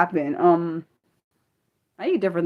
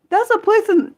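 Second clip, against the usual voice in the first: high, startled read-out; amazed; 13 semitones higher, 3 dB louder than usual. Real, not acted. Streamer reading the place name aloud, amazed. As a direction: blurt it in amazement.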